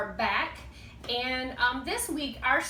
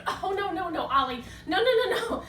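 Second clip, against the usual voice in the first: louder and higher no, no, no to calm the puppet; hammed-up alarm to stop a meltdown; urgent calling out; 6 semitones higher, 4 dB louder, faster than usual. Host stops upset puppet.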